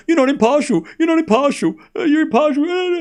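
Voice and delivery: mock the complaining viewer in a silly high voice; mocking whiny chatter. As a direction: parody of chat's flip-flopping complaint. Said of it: "and now you're like".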